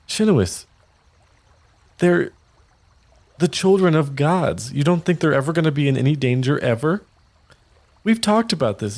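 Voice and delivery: high, patient chiding; Castellan reassuring. Acted. Mother gently chiding Shinowis about the children, high voice. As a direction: remind her gently. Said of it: "Castellan's like".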